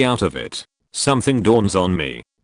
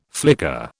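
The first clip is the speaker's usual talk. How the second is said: low flicker; 6 semitones lower than usual. Acted. Narrating the trip loop, a low dropped flicker. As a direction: say it low like a blink of lost time.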